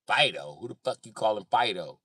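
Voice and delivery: mock offended heckler. Acted heckler answers the insult.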